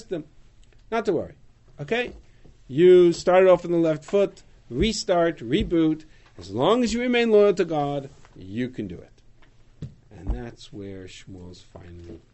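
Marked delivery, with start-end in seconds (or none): 0.9-9.2 louder, encouraging rally; reassuring. Acted. Shmuel encouraging the frightened people, louder and upbeat. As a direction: lift them up, restart and reboot.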